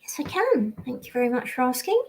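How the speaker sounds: quick and bright like a preteen. Pretend. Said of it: "can you make your voice sound like a preteen?".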